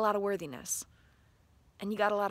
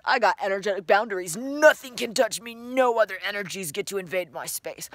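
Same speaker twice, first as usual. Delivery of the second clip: strong protective declaration; guardian power voice; 7 dB louder than usual. Pretend. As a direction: declare your boundaries loudly. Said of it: "tapping into the superpower of guardian parts".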